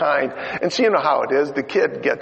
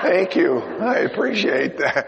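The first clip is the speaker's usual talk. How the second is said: laughing mock thanks; 7 semitones higher than usual. Real, not acted.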